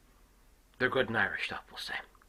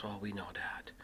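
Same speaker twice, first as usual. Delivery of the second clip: hushed reply; 2 semitones lower, 10 dB quieter than usual. Retold or imitated. Father answering trooper quietly.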